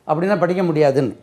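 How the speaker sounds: normal talking voice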